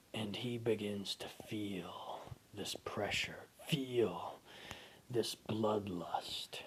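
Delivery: slow unvoiced whisper narration; tense whispered storytelling; hushed horror narration. A narrator's reading. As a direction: whisper the dread.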